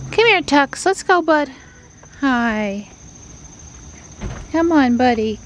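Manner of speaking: high sing-song call to the cat; pet talk. Real, not acted. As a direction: coax the cat in. Calling the cat in.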